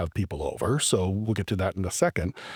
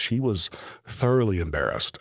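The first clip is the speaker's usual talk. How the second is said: ordinary talk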